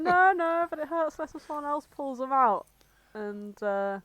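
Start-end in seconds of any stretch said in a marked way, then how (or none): 0.0-2.7 lightly acted, higher insistence; pitched-up kid voice. Sonic offering to pull out quills, raised kid voice. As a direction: raise the pitch like an earnest youngster.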